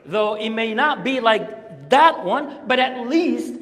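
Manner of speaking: loud, high-pitched preaching; preacher urging listeners to be grateful for a home, impassioned preaching. Real, not acted. Press each phrase home loudly.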